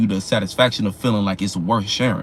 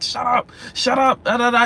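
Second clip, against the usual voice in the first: mock shut up; 11 semitones higher than usual. Talking back at the haters.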